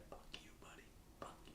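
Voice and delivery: muttered whisper under the breath; whispered curse. Real, not acted. Streamer whispering a curse at the coach on screen. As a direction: hiss it quietly at the screen.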